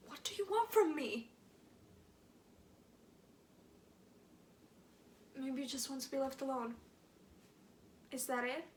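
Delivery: aching, bitter questions to a silent father; wounded and reproachful; hurt pleading. Acted. Monologue to unconscious father.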